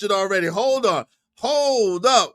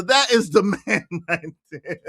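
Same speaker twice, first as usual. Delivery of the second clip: ordinary delivery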